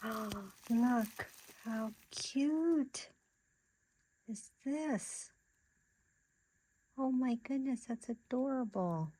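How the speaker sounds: high, slow cooing over something cute; drawn-out delighted cooing; gushing delight. Real, not acted.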